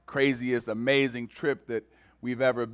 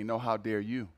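gentle rebuke; 5 semitones lower, 5 dB quieter than usual. Retold or imitated. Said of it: "And God reminded me".

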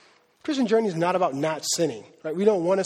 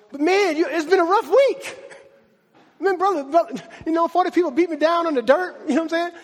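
self-pitying Paul; 7 semitones higher than usual. Pretend. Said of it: "Paul didn't go".

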